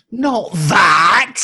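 high, loud cry of protest; exasperated shout. Real, not acted. Co-host asks about the op, exasperated shout back. Exasperated at co-host's off-topic question.

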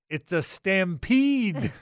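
drawn-out loud exclamation; excited call. Retold or imitated. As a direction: stretch each word out big. Friend's on-air exclamation retold.

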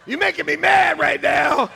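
high, loud pretend outburst; mock anger. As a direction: yell as if the crowd has set you off. Jokes he is angry at congregation.

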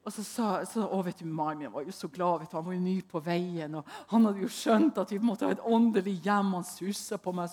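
ordinary delivery

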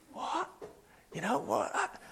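tiny, high hushed what; soft retold astonishment; stunned disbelief. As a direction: imitate dumbstruck friends, barely audible. Stunned friends' faces acted out.

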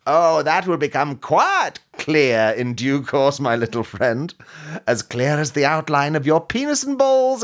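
high, slow hammy supervillain; villain voice. Acted. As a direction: gloat like a pantomime baddie. Villain Twenty twenty voice.

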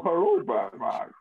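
high, slow, theatrical masked villain; Tom Hardy. Acted. Villain greeting Batman, comic impression. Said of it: "Tom Hardy impression".

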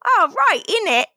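high, hammed-up Cockney British; mock British accent. Co-host joining the British bit, mock accent. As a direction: pitch it up in a silly British accent. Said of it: "Say it in a British accent".

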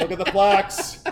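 loud mock outrage through a laugh; indignant retort. Indignant retort repeated amid laughs.

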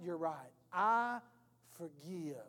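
low, soft, halting admission; subdued surrender, dropping away softly; humbled concession. Acted. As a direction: give in quietly, pausing before the last word. Gives in to God's appeal.

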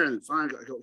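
ordinary delivery

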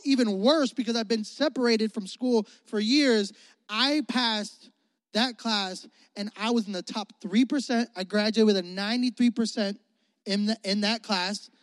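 normal talking voice

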